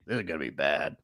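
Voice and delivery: low, soft, resigned mutter; gloomy. Retold or imitated. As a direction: expect the worst, deadpan. JPJ unimpressed, acted quote.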